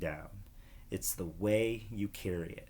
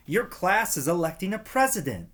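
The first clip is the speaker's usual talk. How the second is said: put-on higher, eager kid voice; little brother's voice; 9 semitones higher, 5 dB louder than usual. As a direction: sound like an excited kindergartner. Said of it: "Steve cried".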